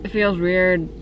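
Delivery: words muffled by gauze in the mouth; talking through gauze. Real, not acted. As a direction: mouth full of gauze after the extraction. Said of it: "farting on a piece of, uh, gauze right now".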